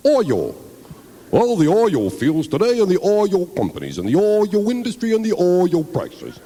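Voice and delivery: anchorman voice stretching "oil" as "oy-yol"; Walter Cronkite impression. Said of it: "Not making fun of Walter".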